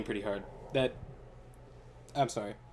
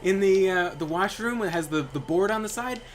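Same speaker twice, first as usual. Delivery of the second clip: higher, louder scolding roommate; mock angry roommate; 8 semitones higher, 5 dB louder than usual. Roommate scolding the messy guy, higher and louder. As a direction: chew him out, loud and high. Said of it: "His roommate was like".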